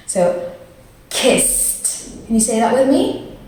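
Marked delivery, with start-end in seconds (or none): 1.1-2.3 "kissed" with a long s closing on a t. Shown as a demonstration. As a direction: hiss the s in "kissed", then release a crisp t. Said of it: "pair that with a T sound".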